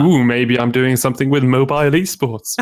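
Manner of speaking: hammed-up teasing "ooh"; mock mysterious. Guest hamming up a tease of his next project, mock mysterious. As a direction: tease it like a playful secret. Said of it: "Teases are meant to be more mysterious".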